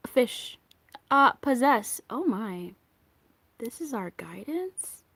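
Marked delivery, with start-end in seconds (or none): 2.1-5.0 hushed, disbelieving whisper; whispered disbelief. Real, not acted. Reader whispering to viewers over a surprising card, hushed. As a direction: breathe the question out, stunned. Reacts to the card she drew.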